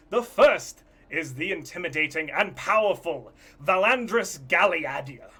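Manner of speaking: loud, high announcer voice; showman host announcing. Acted. The host presents the judges to the audience, booming. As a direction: present the judges with big showbiz flair.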